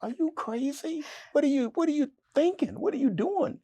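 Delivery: high, fast, alarmed questions; horrified at what he just said; panicked self-talk. Retold or imitated. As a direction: scold yourself in a panic. Panicked self-talk after blurting.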